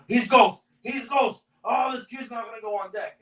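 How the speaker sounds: mock panic, voice jumping high; retelling a frantic boss yelling about a no-show; panicked yelling boss.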